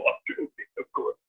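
a breathy, unvoiced confession that it was a joke; whispered aside. Real, not acted. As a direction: whisper it as a quick joking aside. Aside after teasing his partner.

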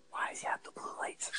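breathy whispered question, no voice at all. Real, not acted. Podcaster retelling a theater question, whispering. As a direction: hush it like you're in a dark theater. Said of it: "I don't know why I'm whispering".

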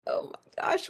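breathy under-the-breath panic; sitting by strangers, whispering a private panic; whispered worry. Retold or imitated. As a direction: whisper it to yourself, scared. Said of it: "sitting next to them like this".

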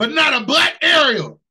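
loud, high outraged question; indignant shout. Real, not acted. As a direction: yell it in disbelief. Indignant rant about Ariel.

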